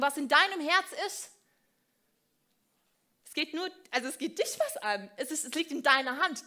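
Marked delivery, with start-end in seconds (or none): none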